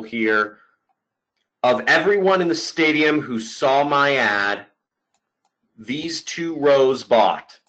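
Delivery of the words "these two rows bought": slow and deliberate; slow emphatic. Real, not acted. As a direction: spell it out slowly. Emphasizing key result to viewers.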